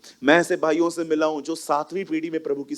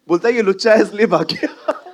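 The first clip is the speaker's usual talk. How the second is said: louder and higher, laughing; laughing tease; 6 semitones higher, 5 dB louder than usual. Real, not acted. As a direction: tease him loudly with a laugh. Repeats the tease.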